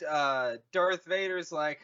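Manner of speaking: usual speaking voice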